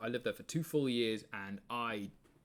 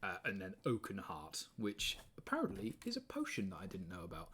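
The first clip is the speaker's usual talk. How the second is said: normal talking voice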